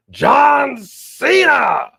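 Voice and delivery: drawn-out hype shout; announcer shout. Acted. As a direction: boom it like a ring announcer. Hypes a wrestling announcement.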